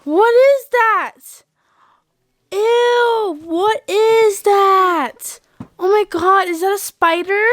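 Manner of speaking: high, slow, disgusted panic; grossed out and scared. Acted. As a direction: squeal in disgust at it. Kids find a spider.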